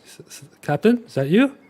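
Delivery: high, stammering and frightened; scared stammer. Acted. Riolus startled by a blade at his throat, high and frightened. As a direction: stutter it out, caught off guard.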